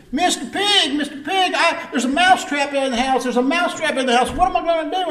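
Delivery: very high-pitched, hammed-up panic; bothered mouse. Mouse running to the pig for help, acted panic. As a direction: squeal it urgently like a frantic cartoon mouse. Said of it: "He was all bothered".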